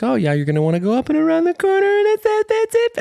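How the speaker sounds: very high, fast puppy squeal; climbing high-pitched excited babble. Retold or imitated. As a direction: voice jumps octaves mid-chat at a puppy. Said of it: "voice go up a couple oc-, octaves".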